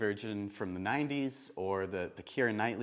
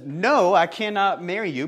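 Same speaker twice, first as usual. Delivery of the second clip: loud, higher-pitched retold refusal; acted-out firm rejection, voice raised; emphatic refusal; 5 semitones higher, 10 dB louder than usual. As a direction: hit NO hard and refuse outright. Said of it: "pretty emphatically".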